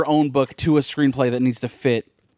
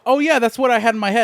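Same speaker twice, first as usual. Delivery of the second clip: much higher-pitched mock breezy voice; breezy retold reply; 11 semitones higher than usual. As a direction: imitate her casually chiming in, pitched way up.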